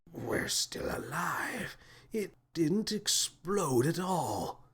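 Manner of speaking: breathless slow whisper, soft; amazed whisper. A narrator's reading. Captain peeks out amazed the ball didn't explode, whispering. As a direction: whisper in wide-eyed disbelief, slowly. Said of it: "he whispered".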